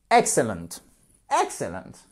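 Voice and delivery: Excellent with EX hit, rest falling away; stress on first syllable. Shown as a demonstration. Teacher models the word for learners, stress on first syllable of "Excellent". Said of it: "Accentuation sur la première syllabe".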